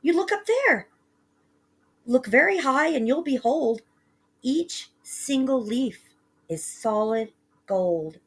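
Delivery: put-on high eager boy slowing to wonder; awed boy. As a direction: point up, then savour the gold. Boy showing gold leaves.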